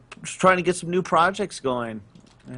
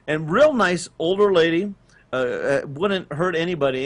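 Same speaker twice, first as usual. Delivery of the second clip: normal talking voice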